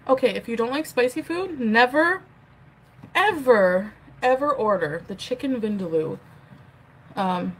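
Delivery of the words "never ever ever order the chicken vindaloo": never, ever, ever punched loud; stress on never ever. Real, not acted. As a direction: hit never and each ever. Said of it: "warning emphasis".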